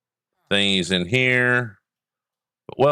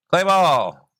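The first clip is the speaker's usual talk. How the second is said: high, loud punny call; playful pun; 9 semitones higher, 4 dB louder than usual. Real, not acted. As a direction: sell the pun brightly. Playful pun, pitch jump.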